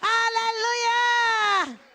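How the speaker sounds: long, stretched shout of praise; drawn-out joyful hallelujah. Real, not acted. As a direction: cry it out to the rafters.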